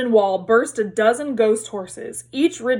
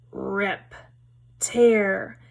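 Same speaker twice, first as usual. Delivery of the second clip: eerie monster voice; much slower than usual. A narrator's reading. Eerie disembodied voice heard.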